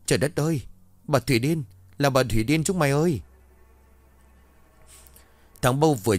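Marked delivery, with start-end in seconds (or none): 0.1-3.2 high, frantic shouting while running away; panicked yell. A narrator's reading. Boy running from the kiln yells to his friends, high and frantic. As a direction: scream the warning as you flee. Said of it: "vừa chạy nó vừa hét lên".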